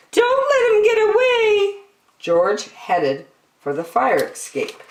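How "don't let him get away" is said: high, loud cry; shouting. A narrator's reading. Woman shouting after George, crying out. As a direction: yell after the fleeing monkey.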